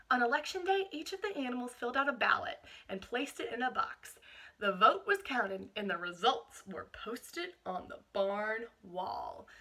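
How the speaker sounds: put-on reading a picture book aloud to children; steady storytelling read-aloud; picture-book narration for a class; storybook reading.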